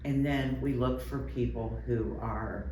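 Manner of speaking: ordinary talk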